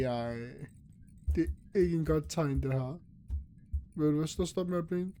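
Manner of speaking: ordinary talk, nothing marked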